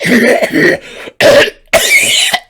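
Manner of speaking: long, loud coughing fit; demonstrated hacking cough. Shown as a demonstration. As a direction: hack and cough repeatedly. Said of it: "You know, like that".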